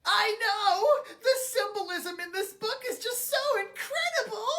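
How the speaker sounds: high, hammed-up laughing sob; fake-cry through gushing praise; mock crying. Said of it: "everyone else is crying".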